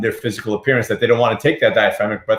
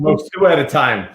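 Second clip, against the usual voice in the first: ordinary talk, nothing marked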